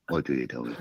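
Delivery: mumbled echo of the deal; quiet repeat. Real, not acted. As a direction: say it again under your breath. Repeats it to himself.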